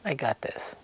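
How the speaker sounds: low, soft imitation of quiet confidence; quietly cocky, voiced low and softly; cocky, retold. Retelling his look at the guys, quiet self-assurance. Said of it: "looks at the guys kinda like".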